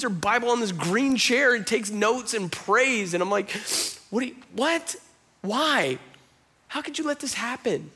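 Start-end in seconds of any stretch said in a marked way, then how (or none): 4.1-7.9 high-pitched, incredulous retold protest; mad at God. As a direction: question God in reenacted disbelief. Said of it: "I remember being so mad at God".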